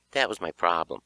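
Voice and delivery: usual speaking voice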